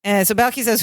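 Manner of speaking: usual speaking voice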